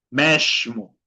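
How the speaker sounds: "mesmo" said meshmo, Rio style. Shown as a demonstration. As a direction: hush the S in "mesmo" into sh. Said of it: "pueden hacer sonido de sh". ("mesmo" con S como sh, al estilo carioca)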